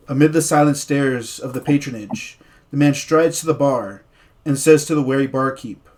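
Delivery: story narration. Acted. DM narrating the opening scene to his players, dramatic storyteller voice. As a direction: paint the foggy tavern for the players.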